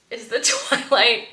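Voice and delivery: ordinary delivery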